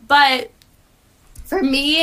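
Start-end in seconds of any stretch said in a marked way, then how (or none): 1.4-2.0 voice cracking up an octave. Real, not acted. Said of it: "my voice just cracked".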